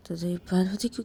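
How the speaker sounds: ordinary delivery, nothing marked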